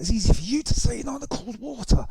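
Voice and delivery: high, strained complaining voice; high mock whine. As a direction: whine it, freezing and fed up. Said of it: "I was like".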